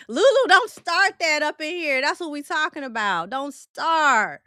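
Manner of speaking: playful scolding. Real, not acted. Host tells Lulu not to start that in here, playful scolding. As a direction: warn them off with teasing force.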